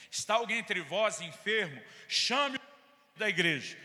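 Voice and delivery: loud, slow, preaching the verse; quoting scripture. Retold or imitated. Pastor rebuking church member with James, loud and slow. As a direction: thunder out the Bible verse. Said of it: "Abra aí sua Bíblia, Tiago, capítulo cinco".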